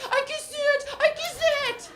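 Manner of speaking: put-on high, loud toddler begging; pleading toddler. Son chasing his friend, high pleading toddler voice. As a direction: chase him with a high pleading kiss it. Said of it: "He is still chasing him".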